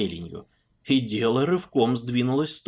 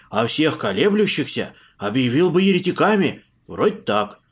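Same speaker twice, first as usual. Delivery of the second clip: loud, eager peasant monk; 6 semitones higher, 8 dB louder than usual. Acted. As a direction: spill it all out loudly. Puzan explaining loudly.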